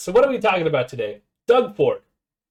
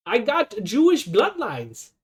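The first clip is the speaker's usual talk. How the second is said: slow, higher, lightly mocking voice; mocking the wife; 6 semitones higher, slower than usual. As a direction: drawl it out as a prissy parody. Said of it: "Who even says bloodlines like that?".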